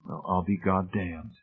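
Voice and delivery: soft, slow and hushed; softly. Acted. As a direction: say it under your breath in wonder. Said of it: "Hamilton said softly".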